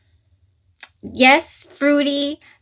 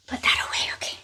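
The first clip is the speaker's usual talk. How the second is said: whispered to herself; quick breathy aside under the breath; 9 dB quieter, much faster than usual. Real, not acted. Aside while tidying up.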